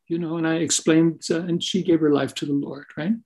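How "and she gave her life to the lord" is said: ordinary delivery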